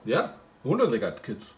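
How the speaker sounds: ordinary talk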